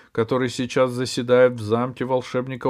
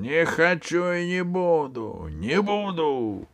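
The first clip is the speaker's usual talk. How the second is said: roaring; 7 semitones higher, slower than usual. Acted. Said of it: "ревел Джин".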